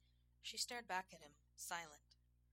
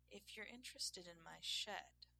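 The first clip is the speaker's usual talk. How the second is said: plain reading voice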